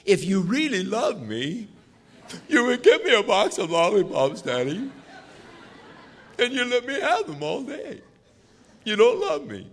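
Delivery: put-on high, pleading little-kid voice with a giggle; whiny child. Child manipulating daddy over lollipops, high whiny kid voice.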